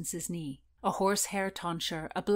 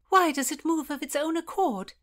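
frightened; 9 semitones higher, 6 dB louder than usual. Acted. Lady Paget frightened by monk.

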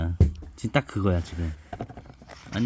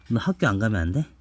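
ordinary delivery, nothing marked

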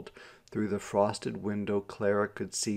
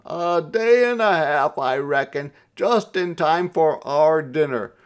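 raised, louder reply from afar; voice calling back; 6 semitones higher, 7 dB louder than usual. A narrator's reading. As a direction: call the answer back over the shoulder. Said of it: "His voice trailed back to them".

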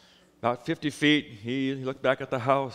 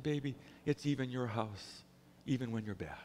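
put-on softer, lower reassurance to a child; tender father; 4 semitones lower, 7 dB quieter than usual. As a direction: gently comfort the little boy.

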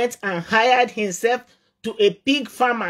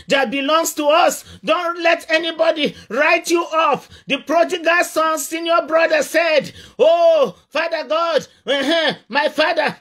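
raised, higher preaching shout; fiery preaching; 4 semitones higher than usual. Acted. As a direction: drive it home to the congregation. Preaching at full force.

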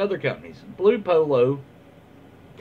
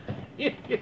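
breathy giggle; 9 semitones higher, 8 dB quieter than usual. Real, not acted. Laughs after finishing the story.

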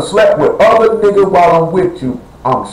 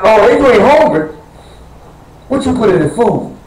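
suspicious retort, pitched higher; mock suspicion; 5 semitones higher than usual. Husband challenging his wife about the food, mock suspicion. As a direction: call out the hidden trick in the food.

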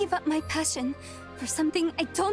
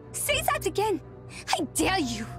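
indignant challenge; 3 semitones higher than usual. Acted. Challenge after an insult.

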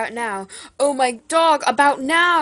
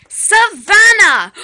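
Savannah! yelled much higher and louder, drawn out; frustrated yell; 9 semitones higher, 17 dB louder, slower than usual. Acted. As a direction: bellow Savannah! across the house, fed up. Yelling after no answer.

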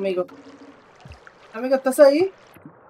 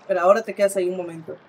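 usual speaking voice

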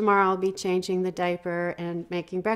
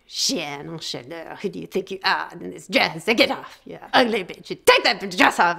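inner critic voice. Retold or imitated. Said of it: "I had my inner nasty, you know, bitch start".

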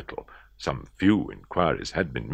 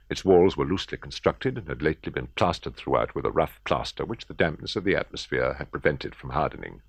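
ordinary delivery

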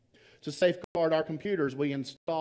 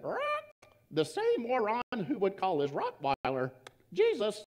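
higher squawky parrot voice; 7 semitones higher than usual. Acted. Parrot answers the thief, higher parrot voice. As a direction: deliver the punchline as a smug bird. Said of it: "He said".

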